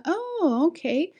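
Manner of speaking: high, patronizing acknowledgment; condescending. Retold or imitated. Coworker reacts to the new teacher, mock condescension. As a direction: mimic a doubtful, belittling colleague. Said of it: "she has that very con-, consen-, condescending".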